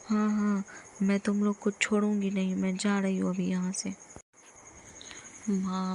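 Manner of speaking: usual speaking voice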